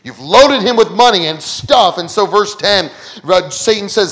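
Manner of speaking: ordinary talk, nothing marked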